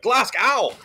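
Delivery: put-on loud, high drunken outburst. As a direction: cry it out like a falling drunk. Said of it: "someone got drunk and fell in a bottle".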